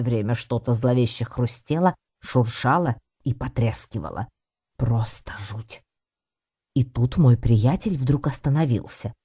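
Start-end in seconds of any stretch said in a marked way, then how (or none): 4.8-5.8 slow, hushed aside; hushed dread. A narrator's reading. Cat confiding his fear of the dark park, hushed. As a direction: say it slowly, half-whispered. Narrator voices the cat's dread.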